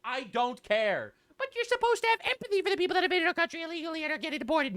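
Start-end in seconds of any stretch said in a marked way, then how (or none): 1.4-4.8 higher, fast, whiny voice of a critic; mock critic voice. Mimicking an imagined critic, high and fast. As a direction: rattle it off like a preachy scold.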